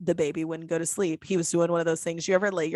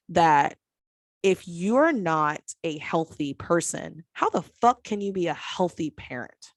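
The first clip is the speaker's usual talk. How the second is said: ordinary delivery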